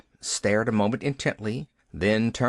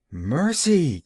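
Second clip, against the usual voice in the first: louder, startled gasp; gasped exclamation; 5 semitones higher, 4 dB louder than usual. Acted. Nancy gasps at the idea of telling the aunt. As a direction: gasp the word in alarm. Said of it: "gasped Nancy".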